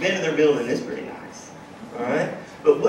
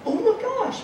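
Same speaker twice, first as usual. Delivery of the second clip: a much higher retold gasp of surprise; retold realization, pitched way up; mock surprise. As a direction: blurt it up high, caught off guard. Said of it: "I'm like".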